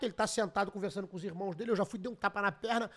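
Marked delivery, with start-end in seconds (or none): none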